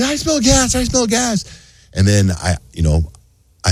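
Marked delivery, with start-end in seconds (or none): 0.0-1.5 high-pitched imitation of a whining wife; exaggerated high whine; mock whining. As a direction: whine it in a squeaky falsetto. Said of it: "then she starts whining".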